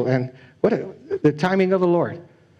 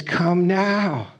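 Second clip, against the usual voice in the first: slow down and plead on the last lines; God pleading; 6 semitones higher, slower than usual. Acted. Preacher voicing God calling sinners to come, higher and pleading. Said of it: "He says".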